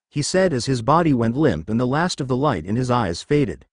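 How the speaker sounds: ordinary delivery, nothing marked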